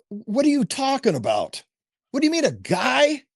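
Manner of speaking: can't believe what he's hearing; incredulous. Retold or imitated. Dad baffled by son's claim.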